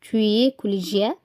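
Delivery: very slow, every syllable clear; slow reading aloud. Shown as a demonstration. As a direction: read the French slowly for learners. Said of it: "كاتب لي هنا مثال". (قراءة بطيئة واضحة)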